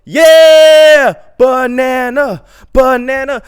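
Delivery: loud, high cheering chant, slow on banana; whole car erupting in a loud banana chant; excited shout. Retold or imitated. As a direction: cheer it like a celebrating crowd.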